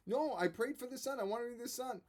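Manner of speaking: high, pleading cry for her son; pleading mother. Retold or imitated. Hannah begging Eli to spare her son, high and pleading. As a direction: beg to keep the child.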